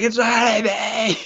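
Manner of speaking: high, slow pained whine; victim in pain. Retold or imitated. Mimicking the man in the chair, slow high pained whine. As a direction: drag it out, high and hurting. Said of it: "He's like".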